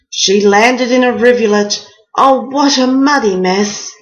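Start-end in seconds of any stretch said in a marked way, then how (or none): none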